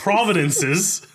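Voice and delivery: sounding out Providences slowly through a laugh; slow, laughing retry. Real, not acted. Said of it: "I can't even say it".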